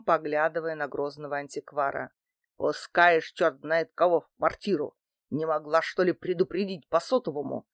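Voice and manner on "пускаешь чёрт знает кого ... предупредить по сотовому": usual speaking voice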